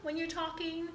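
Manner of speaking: high-pitched squealy demo; talking in a high squeal. Shown as a demonstration. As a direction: lift the voice up into a squeal. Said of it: "if you're squealing".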